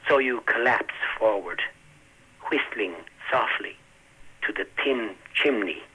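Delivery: slow, measured reading of a poem; poetry reading. Acted. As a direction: read it slowly with long pauses. Poet reads his poem aloud.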